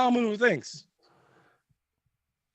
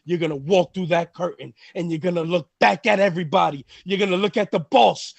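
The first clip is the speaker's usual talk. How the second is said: ordinary talk, nothing marked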